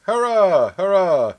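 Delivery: loud high cheer. Real, not acted. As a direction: shout hurrah in celebration. Cheering the 200th episode.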